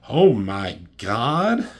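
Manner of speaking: loud, drawn-out disbelief; stunned cry rising at the end; shocked exclamation. Real, not acted. Shocked reaction to the comic scene.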